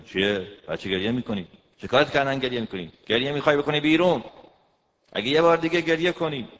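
loud, fast scolding husband; a harsh loud rapid telling-off; angry husband. Retold or imitated. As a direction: snap at the crying wife.

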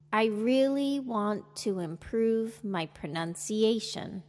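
slow model sentence, "want to" kept as two words. Shown as a demonstration. Teacher modelling intonation for learners to repeat, slowly. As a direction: say it slowly and evenly, word by word. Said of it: "Listen and repeat slowly".

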